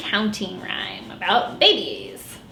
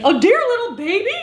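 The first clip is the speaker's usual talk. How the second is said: raised, delighted uncle; fond uncle voice; 8 semitones higher than usual. Acted. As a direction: coo it over the baby.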